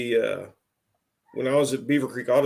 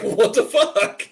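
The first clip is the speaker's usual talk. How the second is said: laughing disbelief; 10 semitones higher, 6 dB louder, much slower than usual. Retold or imitated. Laughing disbelief at being blocked.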